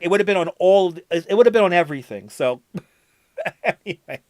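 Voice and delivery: usual speaking voice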